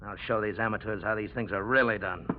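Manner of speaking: ordinary talk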